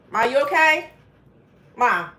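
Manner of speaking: loud, high call across the room; sudden worried call of Ma; calling out to mom. Real, not acted. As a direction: call out to your mother to check on her. Checking on her coughing mom.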